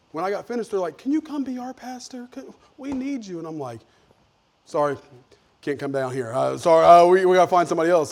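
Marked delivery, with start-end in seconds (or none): 1.0-3.4 high, pleading mock request; eager plea. The relatives begging him to be their pastor, high and eager. 4.6-8.1 louder, hurried apology. Retold or imitated. The preacher turning his relatives down, loud and hurried.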